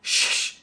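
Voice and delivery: long voiceless "shh"; hissed shush. Retold or imitated. Movie character on the screen shushing, hissed shush. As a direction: hush the room with a drawn-out shh. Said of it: "Taylor Dilton on the screen goes".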